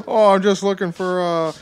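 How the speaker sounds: a hint of a goofy Michigan voice; half-faked innocent prowler with a loud start; Dan Severn. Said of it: "Dan Severn breathing outside your window".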